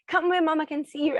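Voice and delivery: put-on mother calling her children at the beach. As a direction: call the kids back where you can see them. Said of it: "I'll be shouting at them".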